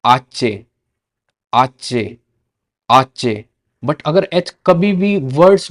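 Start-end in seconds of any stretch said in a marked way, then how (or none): 0.0-3.4 आचे as Spanish "hache"; Spanish letter name. Shown as a demonstration. Teacher to students, careful Spanish letter name. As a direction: repeat आचे slowly for learners. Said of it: "H को बोलते हैं".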